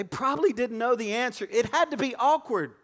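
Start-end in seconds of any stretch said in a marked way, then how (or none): none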